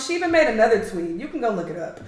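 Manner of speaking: ordinary talk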